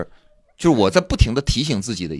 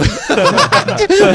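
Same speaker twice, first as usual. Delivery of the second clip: loud laugh; 10 dB louder than usual. Real, not acted. Joking threat, loud laugh.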